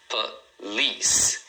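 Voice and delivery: "police" split into a short PUH, pause, LEES; "police" in two separate beats, the second stronger; two syllables. Shown as a demonstration. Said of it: "Duas sílabas".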